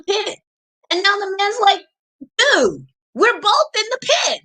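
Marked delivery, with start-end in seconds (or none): 2.4-4.4 higher, incredulous cry; can't believe the helper jumped in. Retold or imitated. Man shocked helper jumped in.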